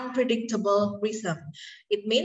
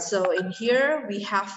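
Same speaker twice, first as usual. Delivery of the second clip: ordinary talk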